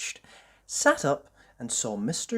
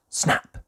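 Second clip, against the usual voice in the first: crisp breathy snap sound; twig snap; 7 dB louder than usual. A narrator's reading. Narrator voicing the twig snapping. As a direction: crack it out sharply.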